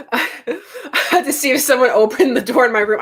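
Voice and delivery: fast giggly talk; laughing. Real, not acted. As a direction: talk through real laughter. Laughing after a loud smack.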